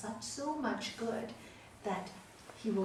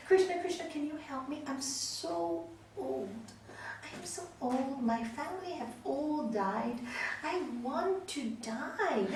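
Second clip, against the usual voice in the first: put-on pleading old man; 6 semitones higher, 8 dB louder than usual. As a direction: beg like a frail old man worried for his cow. Frail old man pleads with Krishna.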